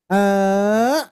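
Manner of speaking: "Eh" dragged out too long as the wrong way; overdone hamzah demo. Shown as a demonstration. Teacher showing the overdone hamzah to avoid. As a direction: push the "Eh" on too long on purpose. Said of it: "nah, nggak gitu".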